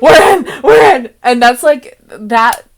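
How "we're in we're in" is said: loud, high, gleeful cheer; excited shout. Retold or imitated. Cheering with a friend over a game win, excited shout. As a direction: yell it out like you just won. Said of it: "and I was going".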